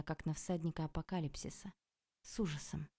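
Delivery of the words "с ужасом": ordinary delivery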